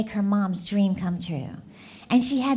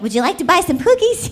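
put-on eager little girl; 6 semitones higher, 7 dB louder, faster than usual. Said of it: "day she's like".

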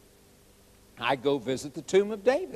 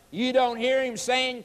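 ordinary talk, nothing marked

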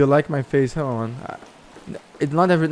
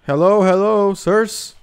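calling out; 5 semitones higher, slower than usual. Real, not acted. Calling out to strangers in game.

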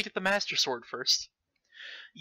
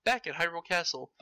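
ordinary talk

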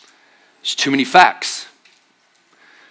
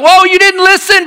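surprised gardener; 4 dB louder than usual. Retold or imitated. Gardener calling after women.

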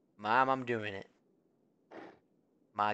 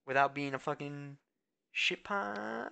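ordinary talk

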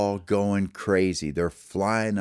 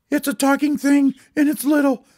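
high, loud cry of alarm; acted panic at a strange creature; 7 dB louder than usual. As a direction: cry out high as a startled bird. Bird alarmed at the elf.

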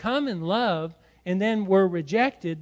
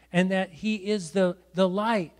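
ordinary talk

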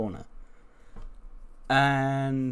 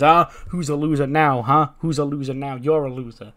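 lightly acted gloating jeer; mock taunting; 3 semitones higher, 6 dB louder than usual. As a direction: imitate a wrestler rubbing it in. Acts out a wrestler taunting his opponent.

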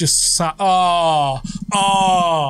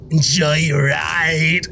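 slow, drawn-out, eerie lilt rising at the end; creepy echo of the driver; slower than usual. Retold or imitated. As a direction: stretch it out like a sinister driver. Echoing the bus driver's line.

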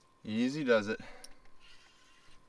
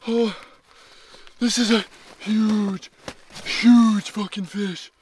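ordinary talk

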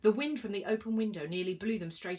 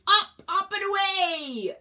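slow, high, loud rallying cry; drawn-out triumphant high yell; 12 semitones higher, 10 dB louder, slower than usual. A narrator's reading. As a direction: yell it long and heroically to launch the horse. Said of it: "yelled Zeus".

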